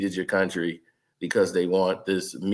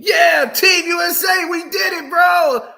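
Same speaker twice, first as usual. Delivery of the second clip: high, loud mock gloat of a fan; shouted mock victory brag; 8 dB louder than usual. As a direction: crow it like a smug winner. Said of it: "if somebody go up there and tell me".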